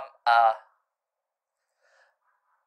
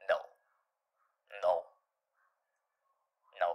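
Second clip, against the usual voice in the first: usual speaking voice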